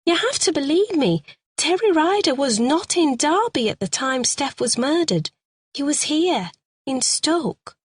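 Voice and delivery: a frightened suspect begging to be believed; Carol, distressed woman. A narrator's reading. Suspect pleads her story to the sergeant, high and pleading.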